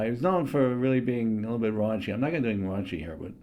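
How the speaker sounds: normal talking voice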